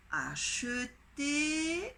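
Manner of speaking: acheter stretched slowly, each syllable spelled out; drawn-out dictation. Shown as a demonstration. Teacher dictating to pupils, slow and drawn out. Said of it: "dictation word drawn out".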